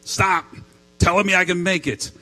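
hammed-up irritated protest; exasperated mock outburst. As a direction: cut him off crossly, playing the wife. Retold wife snaps at encouraging husband.

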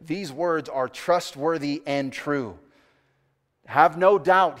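loud, measured words of firm assurance; emphatic, slowing for weight, then hitting hard. Retold or imitated. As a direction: drive each word home with conviction. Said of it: "the text is really emphatic".

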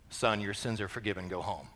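very low, soft pronouncement; mock solemn doctor. Doctor answers a broken arm, mock-solemn murmur. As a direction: drop low and quiet, mock-grave.

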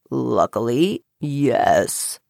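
very low, calm frog; deep, understated frog. A narrator's reading. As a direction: answer deep and untroubled. Frog answers the girl calmly.

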